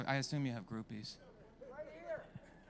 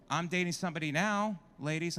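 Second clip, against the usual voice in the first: ordinary delivery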